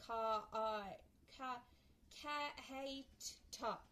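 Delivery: "kha, hai, ta" said as isolated sounds. Shown as a demonstration. Teacher splitting kite into phonemes, "kha, hai, ta" each separate. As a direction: sound out "kha", "hai", "ta" one at a time with gaps. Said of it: "Phoneme is the smallest unit of sound".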